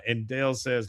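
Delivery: usual speaking voice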